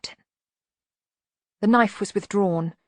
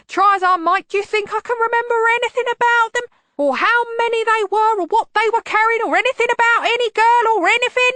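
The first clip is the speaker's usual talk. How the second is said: much higher, loud, rushing nervous babble; high-pitched wheeze; 9 dB louder than usual. A narrator's reading. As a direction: wheeze it out in panic, pitch climbing. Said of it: "his voice was a high-pitched wheeze".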